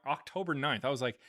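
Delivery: ordinary delivery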